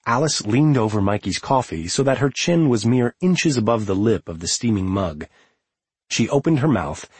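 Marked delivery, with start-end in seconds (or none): none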